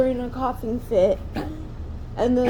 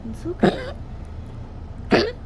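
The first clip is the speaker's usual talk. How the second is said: soothing pet voice; 6 dB quieter than usual. Real, not acted. Soothing a sick dog.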